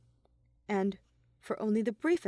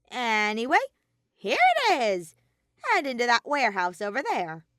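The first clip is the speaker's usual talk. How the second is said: high, louder bear guide; Monokuma voice; 4 semitones higher, 7 dB louder than usual. A narrator's reading. Monokuma guiding Shuichi to the warehouse, high and bright. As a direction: point the way brightly.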